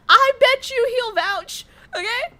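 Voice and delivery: laughing through words. Real, not acted. Laughing while claiming a friend will vouch. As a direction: giggle it out.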